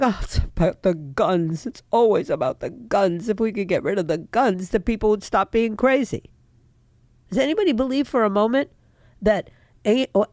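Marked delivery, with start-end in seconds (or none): 0.0-6.3 a sarcastic put-on voice for the other side; half-hearted mock of a naive view. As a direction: lightly sneer at the gun argument. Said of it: "we're sitting here going like".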